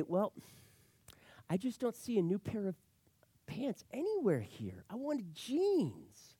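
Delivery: high, soft, slowing whine of letdown; disappointed daughter. Acted. Daughter not seeing new jeans among presents, disappointed. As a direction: draw out the last words in a small, high voice. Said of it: "why are you so disappointed".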